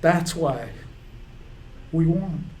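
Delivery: high, tearful voice with long breaks; choked up. Real, not acted. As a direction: fight real tears to get each phrase out. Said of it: "I'm getting choked up".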